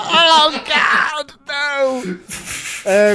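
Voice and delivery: high, wailed mock despair; hammed-up cry of dismay; mock horror. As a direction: wail in pretend horror. Mock horror at choc ices.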